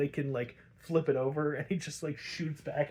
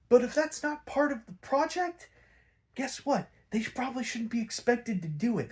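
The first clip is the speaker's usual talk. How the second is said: indignant rant; 6 semitones higher than usual. Retold or imitated. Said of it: "And I'm just like".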